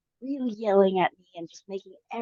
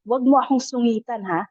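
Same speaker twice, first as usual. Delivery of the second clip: mild mock yell in Tagalog, a bit higher and louder; retold angry yell; 5 semitones higher, 4 dB louder than usual. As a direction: snap it at her like the boyfriend did. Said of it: "yells at me, and he says".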